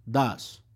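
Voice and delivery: "does" said as das, open a vowel; "does" short and clipped like dos. Shown as a demonstration. Teacher repeats "does" for the class as das. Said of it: "un poquito como más parecido a la pronunciación".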